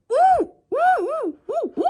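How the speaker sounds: high, loud monkey ooh-ooh; monkey shrieks. A narrator's reading. Principal swinging from lights, high loud monkey hoots. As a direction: hoot like an excited monkey. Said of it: "he shrieked".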